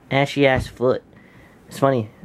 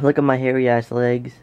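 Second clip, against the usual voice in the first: ordinary delivery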